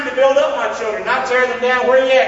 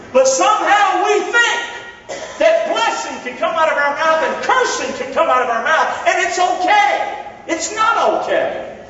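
fervent preaching; 8 semitones higher than usual. Acted. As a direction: preach it out to the crowd. Preaching at full force.

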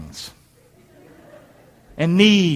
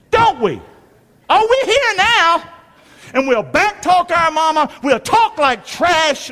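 high, slow, sneering mockery of a cocky kid; mock smug child; 9 semitones higher, 3 dB louder, slower than usual. Preacher mocking children who think they made it alone, sneering. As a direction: sneer it like a know-it-all child.

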